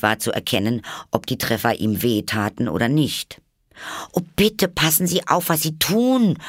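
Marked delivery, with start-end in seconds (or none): 4.1-6.4 high, louder, alarmed plea; frightened cry. A narrator's reading. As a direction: cry out in panic as pans fly. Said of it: "rief Alice, die in großer Angst". (ängstlich und hoch gerufen)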